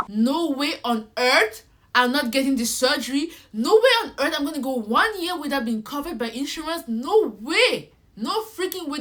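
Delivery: loud, forceful refusal; defiant outburst. Retold or imitated. Refusing the insurance agent, loud and defiant. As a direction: put your foot down and push it out loud. Refusing to lose coverage, on phone.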